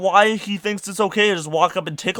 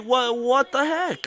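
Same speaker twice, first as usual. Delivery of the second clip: high, slow exasperation; drawn-out disbelief; 10 semitones higher, slower than usual. Real, not acted. Venting about the weird girl, baffled.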